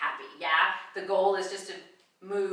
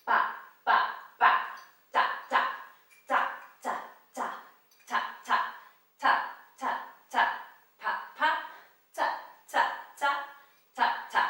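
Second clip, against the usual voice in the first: short percussive "pa" and "ta" syllables; rhythmic vocal counting; 6 semitones higher, 4 dB louder than usual. Shown as a demonstration. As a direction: mark each dance accent with a crisp syllable. Vocalizes accents of the dance.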